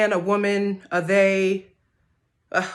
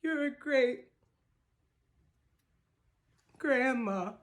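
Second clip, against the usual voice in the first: sung line with the voice breaking; choked-up singing fading to almost nothing; voice cracking; 4 semitones higher than usual. Real, not acted. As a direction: let emotion crack the voice as you sing. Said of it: "my voice was cracking at the end".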